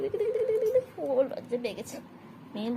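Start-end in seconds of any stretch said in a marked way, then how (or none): 0.0-0.9 1 high coaxing. Real, not acted. Coaxing pet playfully.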